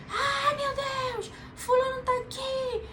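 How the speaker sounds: high, slow, panicked cry of alarm; panicked shriek. Shown as a demonstration. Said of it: "nada de gritar". (grito desesperado, bem agudo e lento)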